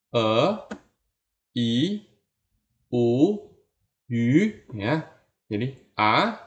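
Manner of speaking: a o e i u yu each rising from mid; rising vowel drill; second tone vowels. Shown as a demonstration. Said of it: "jadi sedang naik".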